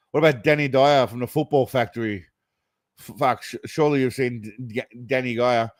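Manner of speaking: ordinary talk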